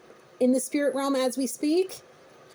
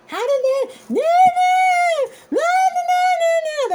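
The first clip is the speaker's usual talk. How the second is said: shrill high shouting tantrum, much louder; child's tantrum; 7 dB louder, slower than usual. Retold or imitated. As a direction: throw a screaming child's fit. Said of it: "somebody is having a child's tantrum".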